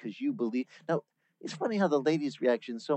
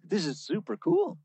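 amazed; 6 semitones higher than usual. Retold or imitated. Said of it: "It's just like".

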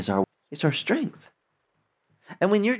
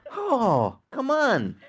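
mock exasperated, pitched higher; exasperated groan; 9 semitones higher, much slower than usual. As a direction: roll your eyes at the fakery. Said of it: "It makes me just like".

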